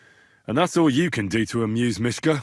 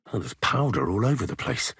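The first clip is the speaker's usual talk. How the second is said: ordinary delivery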